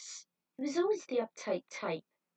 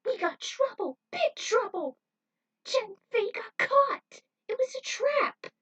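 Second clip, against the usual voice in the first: high, loud, panicked warning; alarmed shout; 14 semitones higher, 7 dB louder than usual. A narrator's reading. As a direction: shout the bad news in a rush. Warning of the trap.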